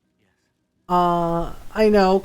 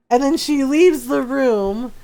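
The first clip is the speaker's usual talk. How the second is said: ordinary talk, nothing marked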